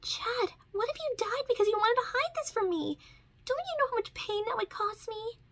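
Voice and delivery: high, upset reproach; angry and upset. Acted. Ladybug to Chat who hid his wound, upset high scolding. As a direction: scold him, hurt and angry. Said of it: "angry and upset".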